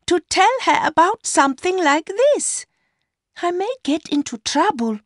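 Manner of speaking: put-on high-pitched young woman's voice; Mercy, justifying herself. A narrator's reading. Mistress justifying herself to her lover, high young woman's voice.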